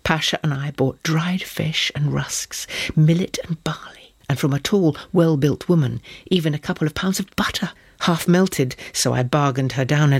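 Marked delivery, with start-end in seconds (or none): none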